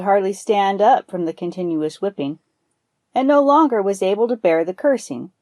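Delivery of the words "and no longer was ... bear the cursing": plain reading voice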